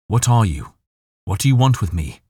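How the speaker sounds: calling out. A narrator's reading. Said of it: "I called out".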